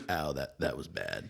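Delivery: low, understated pained remark; deadpan character voice after getting hurt; Zeb's imagined voice. Acted. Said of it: "that's how I imagine how he talked".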